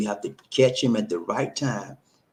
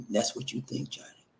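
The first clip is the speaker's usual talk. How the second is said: ordinary talk, nothing marked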